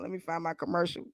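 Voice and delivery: ordinary talk, nothing marked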